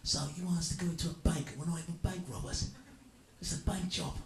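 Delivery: hammed-up action hero voice; Jason Statham. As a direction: spell out the obvious like a gruff hero. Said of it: "He goes".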